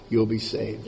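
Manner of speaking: normal talking voice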